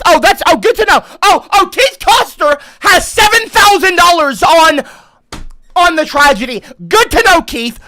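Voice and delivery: high, loud mock-cheerful voice; sarcastic. Real, not acted. Streamer mocking Keith's seven thousand dollar bid, sarcastic high praise. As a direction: ham up fake delight to mock him.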